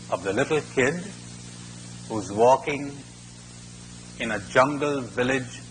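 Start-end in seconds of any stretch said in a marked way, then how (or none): none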